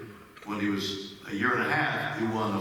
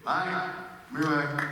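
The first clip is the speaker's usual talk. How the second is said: normal talking voice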